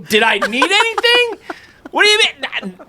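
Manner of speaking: very high, laughing indignant cry; mock wounded outrage. Sick friend scolding people who never came, very high mock outrage. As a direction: play hurt and betrayed, over the top.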